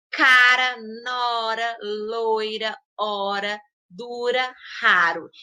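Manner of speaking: weak, lingering tapped R in Cara, nora, loira; R fraco. Shown as a demonstration. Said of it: "eu usei ela com entonação mais fraca, mais lenta". (R fraco e lento em Cara, nora, dura)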